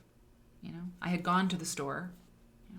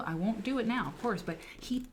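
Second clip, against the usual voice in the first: usual speaking voice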